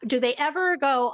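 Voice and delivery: normal talking voice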